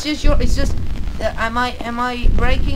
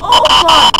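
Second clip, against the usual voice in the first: loud frightened curses, higher; panicked yelling; 6 semitones higher, 8 dB louder, slower than usual. Real, not acted. Player caught by the monster, loud panicked yelling. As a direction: yell in real panic. Monster catches him in game.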